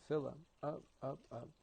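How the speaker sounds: rising steps, lightening at the top; coaxing upward. Shown as a demonstration. Coaxing a fuller breath in.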